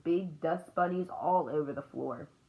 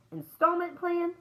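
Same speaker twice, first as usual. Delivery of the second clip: high, slow questioning echo; incredulous customer-service query; puzzled question; 12 semitones higher, 3 dB louder than usual. A narrator's reading. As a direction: repeat it back slowly, pitched way up. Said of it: "said the man".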